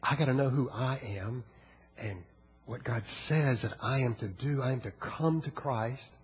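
quiet altar call. Acted. Preacher softly appeals to congregation over music, hushed. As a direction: draw them in, low and intimate.

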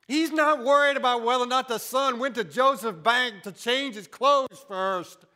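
loud preacher's rise; loud impassioned sermon; preaching at full force. Acted. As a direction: preach it to the back pews.

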